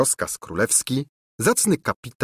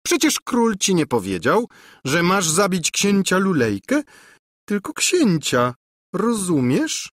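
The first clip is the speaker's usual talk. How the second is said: high, sly prodding; hinted scheming housekeeper; 10 semitones higher than usual. A narrator's reading. Housekeeper explains to captain. (chytra ochmistrzyni tłumaczy kapitanowi)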